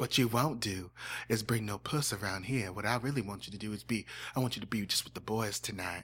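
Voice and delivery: low-key country talk, quietly laying down rules; Dean Sessions. Acted. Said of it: "Welcome back, Dean Sessions".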